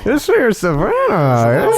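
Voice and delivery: put-on slow, high, old-timey welcome; wholesome 1950s kid. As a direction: greet like a cheerful fifties youngster. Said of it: "He could do his nineteen fifties voice".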